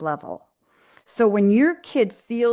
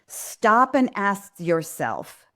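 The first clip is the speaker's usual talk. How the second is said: usual speaking voice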